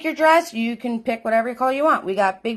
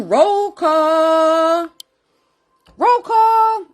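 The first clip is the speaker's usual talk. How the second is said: high, slow sing-song call; announcer roll call; 6 semitones higher, 6 dB louder, much slower than usual. Acted. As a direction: announce it like a show host.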